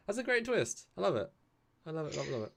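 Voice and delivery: excited. Real, not acted. Gushing over episode.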